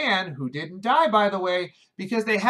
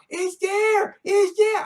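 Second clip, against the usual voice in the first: put-on much higher, louder excited kids' cry; kids shouting out; 12 semitones higher, 4 dB louder, much slower than usual. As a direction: point it out like eager children. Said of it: "the kids are about to go".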